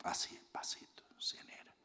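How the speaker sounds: soft unvoiced whisper, barely there. Shown as a demonstration. Preacher showing the congregation how to speak softly, whispering. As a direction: breathe it out as a gentle hush.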